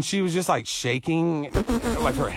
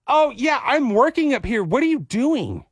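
put-on higher, chirpy kid voice; little girl voice; 7 semitones higher than usual. Girl explaining her pretend job, higher kid voice. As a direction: sound like a busy three-year-old.

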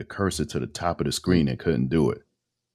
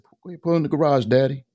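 imitated kids; 11 semitones higher than usual. Kids in the car telling dad to pull in, imitated high-pitched urging. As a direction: pipe up like a kid calling to a parent.

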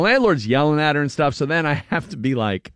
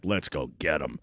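low eager tough guy; 10 semitones lower, 9 dB quieter than usual. Retold or imitated. Irish guy eager to fight.

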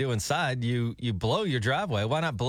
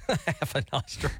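laughing; slower than usual. Real, not acted. Laughing repeat of cohost's joke.

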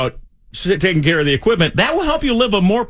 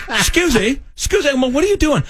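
much higher mock-indignant demand; imitating wife's indignation; 10 semitones higher than usual. Imitating wife catching him teaching the dog, high and indignant. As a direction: play the outraged wife, pitched way up. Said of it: "She's like".

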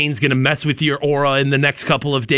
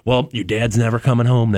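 low, understated mock-sad line; a touch of put-on gloom, pitched down; mock sadness; 7 semitones lower than usual. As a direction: lightly act out breaking sad news. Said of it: "or the sadness of".

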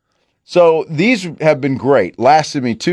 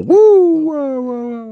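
echoing tunnel; 13 semitones higher, much slower than usual. Shown as a demonstration. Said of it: "I sound like I'm in a tunnel".

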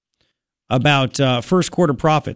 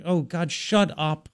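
embarrassed aside; 8 dB quieter, much slower than usual. Retold or imitated. Embarrassed host reacting to neighbor's introduction, hushed dismay. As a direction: say it softly, wishing it would stop.

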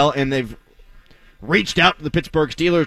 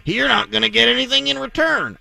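lampoon them with a put-on Southern accent; jokey Southern accent, pitch climbing on the last phrase; mock Southern accent; 5 semitones higher than usual. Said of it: "I don't know why I made them Southern".

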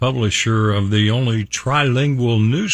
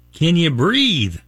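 slow, higher-pitched hillbilly drawl; drawn-out hillbilly question, pitched up; hillbilly accent; 5 semitones higher than usual. Acted. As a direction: stretch it out like a hillbilly. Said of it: "I've gotta use a hillbilly accent".

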